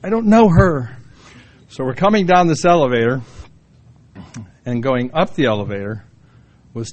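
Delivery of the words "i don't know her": put-on embarrassed teenager. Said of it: "it was like".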